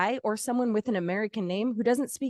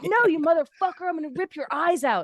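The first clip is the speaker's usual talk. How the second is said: mock angry customer; 8 semitones higher than usual. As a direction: ham up an enraged caller yelling at a bot. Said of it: "And you're like".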